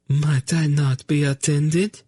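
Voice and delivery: high, slow and timid; a frightened lady asking meekly; small, high, hesitant plea; small trembling voice. Acted. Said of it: "in a small, trembling voice".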